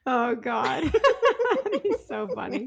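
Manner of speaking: laughing through the words. Real, not acted. Friend asked to do her backwoods impression, laughing. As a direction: crack up while talking.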